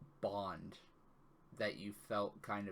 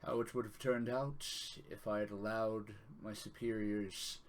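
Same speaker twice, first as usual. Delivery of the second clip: grave, measured divine speaker; solemn deity voice. Acted. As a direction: speak as a solemn god to his champion. GM voicing a deity's message.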